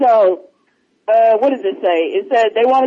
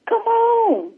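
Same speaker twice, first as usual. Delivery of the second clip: excited; 11 semitones higher than usual. Real, not acted. Excited reacting to friend.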